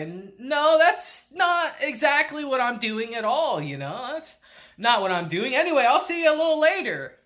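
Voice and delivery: mock-nervous stammer getting away; flustered stammer. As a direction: stumble over the excuse, caught out. Ko flustered, sweating bullets.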